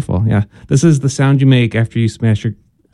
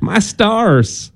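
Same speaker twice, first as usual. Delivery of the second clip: a Southern lilt, pitched up; a Southern lady, mildly taken aback; light Southern accent; 7 semitones higher than usual. Shown as a demonstration. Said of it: "you would only say that with a southern accent".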